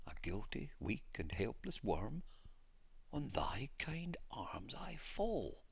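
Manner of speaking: hushed hymn quote. A narrator's reading. Mary recites the hymn lines.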